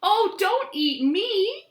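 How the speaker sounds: high little pleading inchworm voice. Acted. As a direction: plead high as a tiny worm. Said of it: "said the inchworm".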